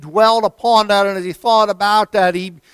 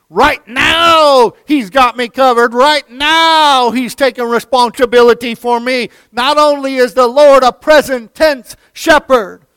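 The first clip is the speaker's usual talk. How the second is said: higher and louder, hammering each phrase; rousing pulpit cry; full-force preaching; 8 semitones higher, 9 dB louder than usual. Acted. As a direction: preach it out with fire.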